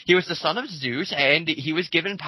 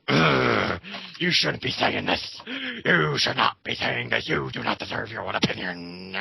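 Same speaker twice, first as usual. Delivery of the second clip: lower, slow, sneering mock scold; lightly voiced preachy follower, pitched lower; 4 semitones lower than usual. Mocking Vicious's followers scolding a critic, lower mock sneer.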